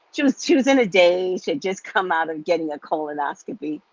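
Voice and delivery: ordinary delivery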